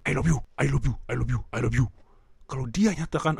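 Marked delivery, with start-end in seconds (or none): 0.1-1.9 wrong tone. Shown as a demonstration. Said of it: "dengarkan baik-baik dan rasakan".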